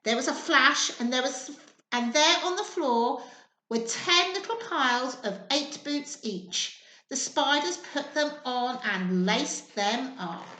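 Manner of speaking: plain reading voice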